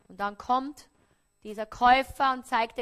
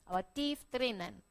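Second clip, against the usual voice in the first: ordinary talk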